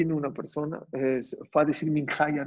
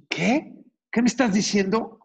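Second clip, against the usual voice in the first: high, astonished questioning; retold astonishment; 7 semitones higher, 4 dB louder than usual. Father hears why daughter cries outside, acted astonishment. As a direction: react in disbelief, pitched well up.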